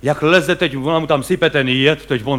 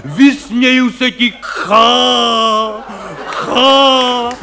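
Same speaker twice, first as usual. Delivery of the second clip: theatrical bombast, laughing at death; hammed-up heroic roar; 4 semitones higher, much slower than usual. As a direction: play the ham actor defying death at the top of his lungs. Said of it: "prostě řvou".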